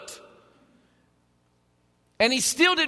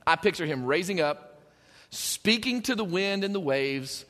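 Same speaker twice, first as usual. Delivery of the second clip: ordinary talk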